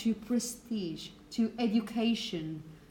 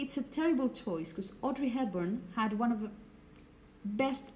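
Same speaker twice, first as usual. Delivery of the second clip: ordinary talk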